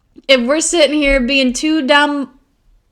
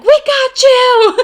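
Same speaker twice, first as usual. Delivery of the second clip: 1 excited cheer; 12 semitones higher, 8 dB louder, much slower than usual. Retold or imitated. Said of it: "We were like".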